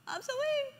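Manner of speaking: very high, soft, feeble whimper; mock weakness. Preacher mimicking the wrong thing to say when weak, mock-feeble. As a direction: put on a pitiful little complaint. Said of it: "When you feel weak".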